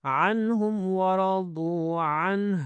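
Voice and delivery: slow, drawn-out tajweed chant; Quran recitation. Shown as a demonstration. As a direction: recite with long held vowels.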